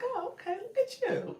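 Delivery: high, mocking snide voice; snide mimicry. Streamer mimicking point of contact's snide remark, high and mocking. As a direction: imitate a sly put-down. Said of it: "she was being a little funny".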